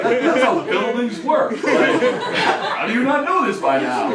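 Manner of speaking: hammed-up incredulous exasperation; exasperated outburst. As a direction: raise the voice in disbelief at a clueless child. Exasperated explaining to little Billy.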